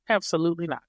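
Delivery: ordinary delivery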